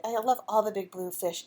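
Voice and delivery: usual speaking voice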